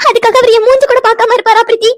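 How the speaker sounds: high-pitched tearful wail; crying. Acted. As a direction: sob the words out in distress. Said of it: "Vino, அழுகாதடி". (அழுதுகொண்டே புலம்பும் குரல்)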